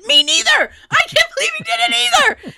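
high, loud crying wail; upset wail. Retold or imitated. Wailing to her husband about the show. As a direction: wail it like it's a tragedy.

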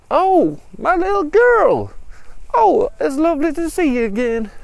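high, loud hammed-up fondness; gushing father. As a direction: coo loudly over a long-lost child. Father gushes over his daughter.

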